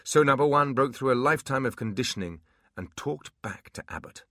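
usual speaking voice

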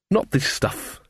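understated disgust; a touch of bitter contempt; bitterly. A narrator's reading. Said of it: "she said bitterly".

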